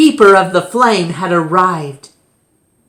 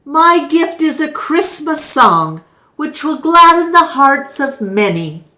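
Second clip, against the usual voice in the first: proud minstrel; 8 semitones higher, 4 dB louder than usual. Acted. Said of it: "cried one".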